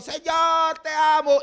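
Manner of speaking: high, loud hammed-up plea; mock emotional outcry, high and loud; mock tearful worshipper. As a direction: parody an overwrought cry. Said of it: "gente llorando a moco tendido".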